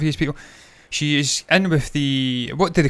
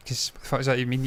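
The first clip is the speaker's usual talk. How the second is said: ordinary delivery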